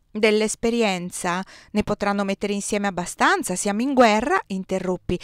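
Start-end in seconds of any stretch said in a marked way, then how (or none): none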